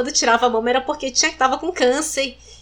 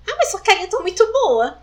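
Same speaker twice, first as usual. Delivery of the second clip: much higher, sing-song doubting voice; imitating the old lady on the bus saying she looks too well; old lady's dismissal; 8 semitones higher than usual. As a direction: mimic the old lady brushing her off. Old lady doubting her illness.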